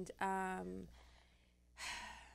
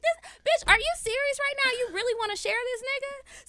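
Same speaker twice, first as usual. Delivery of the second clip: much higher and louder, shrieking indignation; outraged disbelief; 13 semitones higher, 4 dB louder than usual. Retold or imitated. Telling friends how she reacted to sharing her boyfriend, outraged. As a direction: blast her with shocked outrage.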